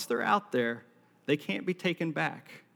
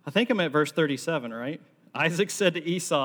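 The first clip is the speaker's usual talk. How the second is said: ordinary delivery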